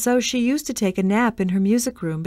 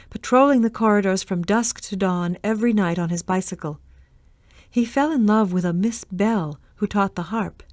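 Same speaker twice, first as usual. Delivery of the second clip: ordinary delivery, nothing marked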